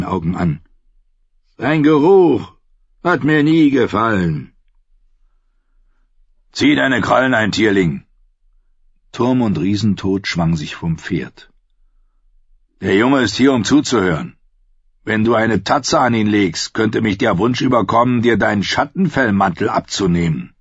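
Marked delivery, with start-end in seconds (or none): 1.6-4.5 higher, louder eerie voice; a warg sneering about Jon's smell; Varamir the skinchanger. Acted. Varamir speaks. 6.5-20.5 a hint of a gruff, warning wildling; a big wildling warning the skinchanger off the boy; Tormund, lightly voiced. Tormund warns Varamir.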